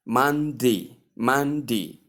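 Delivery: Monday said as man-di; Monday ending in i. Shown as a demonstration. Teacher demonstrating the short-i Monday. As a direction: finish Monday with a short i sound. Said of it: "So when you put it here".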